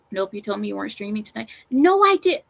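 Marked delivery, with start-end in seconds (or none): 1.7-2.4 sudden high, loud denial; loud protest. Real, not acted. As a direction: blurt out the denial. Streamer caught out, protests loudly.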